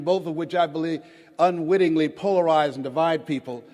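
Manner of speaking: usual speaking voice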